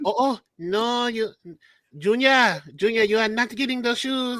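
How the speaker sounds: put-on high, drawn-out Haitian accent scolding Junior; a Haitian parent refusing, with a laugh breaking in. Haitian accent, parent tells Junior no to the shoes. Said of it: "The Haitian parents be like".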